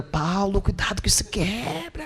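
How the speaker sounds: soft, slow, breathy warning; imitating wife's warning. Pastor imitates his wife warning about eggs, slow soft whisper. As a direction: hush it like fragile eggs. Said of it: "minha esposa comprou ovo lá e fala".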